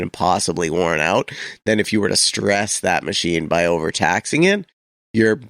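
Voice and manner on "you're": ordinary delivery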